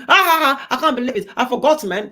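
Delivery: high, loud laughing imitation; high loud mock laugh. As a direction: laugh it off like he's mocking her. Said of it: "He was the one laughing".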